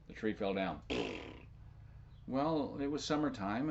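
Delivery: usual speaking voice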